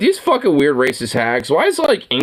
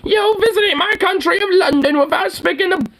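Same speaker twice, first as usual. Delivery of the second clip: mock old hag; 12 semitones higher than usual. Mocking the woman in the video, high and sneering. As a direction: lampoon her in a raised mocking voice. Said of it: "It's like, fucking old hag".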